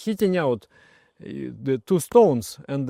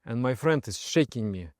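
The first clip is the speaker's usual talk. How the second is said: ordinary delivery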